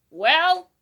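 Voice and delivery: cranky owl shouting; scratchy old owl. A narrator's reading. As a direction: bellow like a deaf old bird woken up. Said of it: "said in a scratchy voice".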